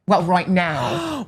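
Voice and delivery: very slow, stretched Jersey vowels; bad Jersey accent. Joining the mom bit, very slow Jersey accent. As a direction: drag it out in mock Jersey. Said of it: "Jersey accent".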